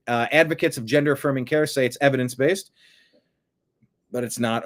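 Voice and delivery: ordinary talk, nothing marked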